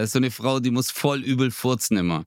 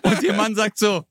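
lachend; 7 semitones higher than usual. Acted. Pointe, Polizist im Schlaf.

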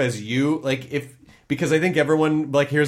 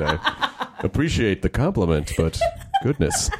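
much lower, put-on radio voice, amused; hammed-up deep voice; 7 semitones lower than usual. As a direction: ham up the deep announcer voice as a joke. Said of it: "you've got a great voice".

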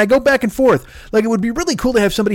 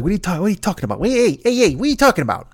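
bad Pesci; faster than usual. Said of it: "That's the worst Pesci of all time".

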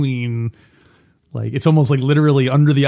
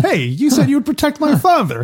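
much higher, goofy preppy voice; 12 semitones higher than usual. Pretend. Parody Mikey confronting the father's protector, much higher. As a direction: squeak it like a clean-cut college kid.

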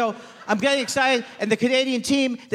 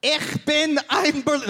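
slow, higher German speech parody; mock dictator impression; 6 semitones higher than usual. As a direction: declaim it slowly like a dictator. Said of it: "that was Mussolini's Hitler impression".

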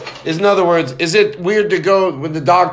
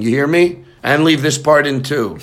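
ordinary delivery

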